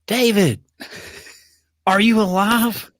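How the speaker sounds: imitated distant yell. Matt half a mile away checks on his friend, mock yelling. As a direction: imitate a friend calling out from far off. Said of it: "and Matt yelling".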